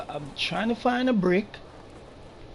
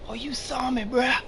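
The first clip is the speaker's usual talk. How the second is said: panicked; 6 semitones higher than usual. Real, not acted. Spotted again, higher pitch.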